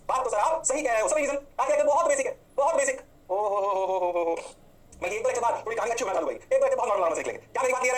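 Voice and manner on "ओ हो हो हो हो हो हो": slow drawn-out playful oho ho ho; sing-song oho. Real, not acted. Teacher teasing the class with a slow oho ho. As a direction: stretch the oho ho ho out slowly, teasing.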